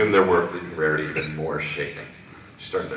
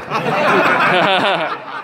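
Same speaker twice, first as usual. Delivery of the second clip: high, loud peal of laughter; burst of laughter; 10 dB louder than usual. Real, not acted. Reader laughing at own pun, high loud laughter.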